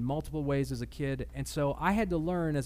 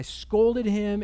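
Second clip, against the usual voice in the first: emphatic; 6 semitones higher, 8 dB louder than usual. Real, not acted. Recalling anger at son.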